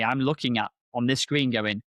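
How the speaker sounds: usual speaking voice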